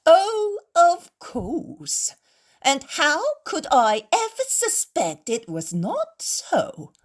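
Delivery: sarcastic, lightly mimicking sweet Pharisee voice, slowed; mocking imitation. A narrator's reading. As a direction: parrot their fawning back at them with scorn. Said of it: "The host imitates their voices and gestures".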